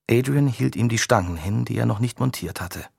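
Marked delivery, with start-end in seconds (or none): none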